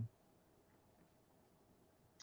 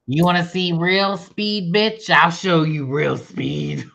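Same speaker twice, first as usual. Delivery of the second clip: cocky speedster voice; 4 semitones higher, 4 dB louder than usual. Pretend. Playing the speedy hedgehog.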